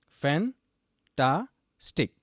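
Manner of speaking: fan-ta-stick said very slowly, one syllable at a time; split into syllables. Shown as a demonstration. Teacher counting out fan-ta-stick slowly for students. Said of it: "the word fantastic has three syllables".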